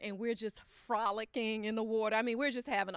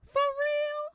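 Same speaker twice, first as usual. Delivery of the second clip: very high, louder squeal; squealing delight; 6 dB louder than usual. Retold or imitated. Wife thrilled by husband's promise, squealing.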